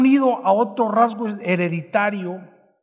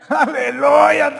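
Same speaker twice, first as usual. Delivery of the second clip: laughing hallelujah; 4 semitones higher, 4 dB louder, much slower than usual. Real, not acted.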